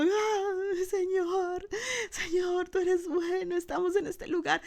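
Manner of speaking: high-pitched tearful wail to God; weeping praise. Acted. Pastor acting the elders crying before God, weeping praise. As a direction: cry out the words as if overcome. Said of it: "mientras los ancianos estaban llorando".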